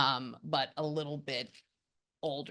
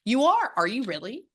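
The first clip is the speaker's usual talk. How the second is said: a sudden high, louder question of disbelief; surprised; 7 semitones higher, 4 dB louder than usual. Real, not acted. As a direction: ask it as if you can't believe the year. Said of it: "I get very surprised".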